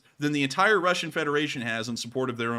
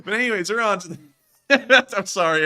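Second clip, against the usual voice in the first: ordinary talk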